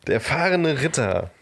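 slow, grand title reveal; dramatic announcement. Acted.

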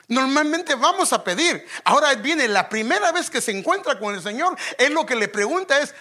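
loud, high fervent sermon voice; full-force preaching. Acted.

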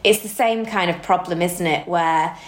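usual speaking voice

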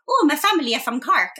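high, put-on Irish lilt; exaggerated Irish accent. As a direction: mimic an over-the-top Irish accent. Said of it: "This is not a real accent".

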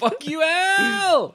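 high, slow stretched-out yell; drawn-out yell. Pretend. Mock betrayal scene shouting at Al.

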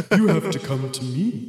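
slow, eerie tempter; creepy, slow disembodied voice. Acted. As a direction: draw it out softly, like an unseen presence luring them in. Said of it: "Is this, like, in a creepy, creepy way?".

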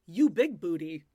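loud, punchy imitation of Hamza; loud mock accent. Host imitating the groom teasing his wife, loud and playful. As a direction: bark it out like the groom. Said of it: "And he goes".

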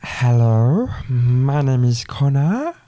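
slow put-on voice; a slow, drawn-out new voice for the podcast; trial new voice. Shown as a demonstration. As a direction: try on a new voice, slowly. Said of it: "try a couple of them on you".

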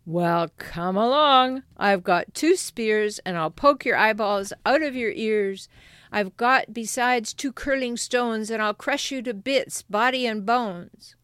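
loud, defiant billy goat voice; hoarse big goat. Acted. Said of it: "who had an ugly, hoarse voice".

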